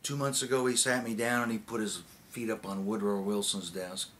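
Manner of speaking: ordinary delivery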